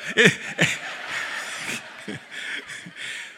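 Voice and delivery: airless wheezing laugh; breathless laugh. Real, not acted.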